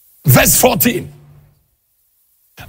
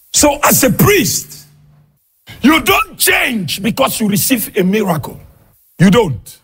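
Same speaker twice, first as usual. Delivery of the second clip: fiery preaching; 6 semitones higher than usual. Acted. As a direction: preach it hard, half shouting to the congregation. Preaching at full force.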